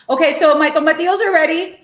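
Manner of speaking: usual speaking voice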